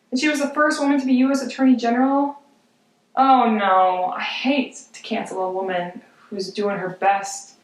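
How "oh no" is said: ordinary talk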